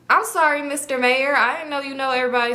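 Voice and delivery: mock-apologetic and high; snarky fake apology; sarcastic. Sarcastic retort to nosy guy.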